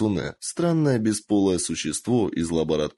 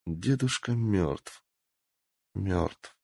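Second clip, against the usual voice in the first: stunned grief; 5 dB quieter, much slower than usual. Acted. Girl grieving her grandfather.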